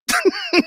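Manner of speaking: short high burst of laughter; high wheezy laugh; helpless laughter. Real, not acted. Cracks up again.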